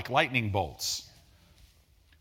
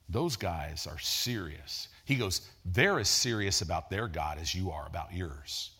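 a hushed, lower imitation of the roommate's warning; grave friend; 8 semitones lower, 5 dB quieter than usual. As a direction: drop the voice to sound deadly serious.

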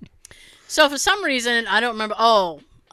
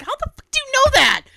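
high, fast incredulous squeal; shocked disbelief; 5 dB louder, faster than usual. Retold or imitated. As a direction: squeak it out, amazed. Retold disbelief at husband.

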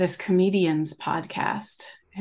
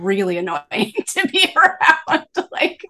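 laughing; 3 dB louder than usual. Real, not acted. Laughing at her younger self.

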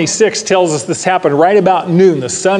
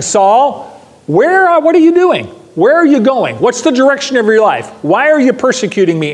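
raised, much higher voice pressing each question; urgent divine call; 6 semitones higher than usual. Acted. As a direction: press Saul hard with every question, voice climbing. God confronting Saul, voiced urgently.

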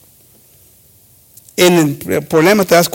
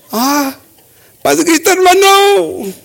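high, drawn-out churchy hello; hammed-up sweet greeting to a fellow churchgoer; mock-friendly greeting; 11 semitones higher, slower than usual. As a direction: put on sudden piety. Acted awkward church greeting after quarrel.

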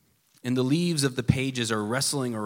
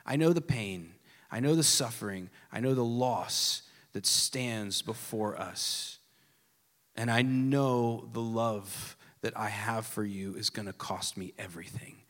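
God's vow; 6 semitones lower, 4 dB quieter than usual. Acted. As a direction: promise it gently, knowing the cost. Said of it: "He said".

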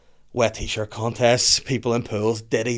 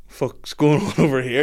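puffed-up challenge, a bit slow, laugh breaking in; mock tough guy; 3 semitones higher, 3 dB louder than usual. Lads confronting the strangers by the girls, mock tough. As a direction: chest out, square up to the strangers. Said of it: "chest pumped out".

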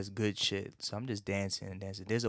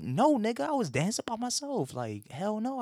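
much higher and louder, a shrill indignant yell; outraged denial; 12 semitones higher, 4 dB louder than usual. Retold or imitated. To the security guard who accuses him, high outraged shout. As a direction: shriek the denial like you're offended anyone would ask. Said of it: "I was like".

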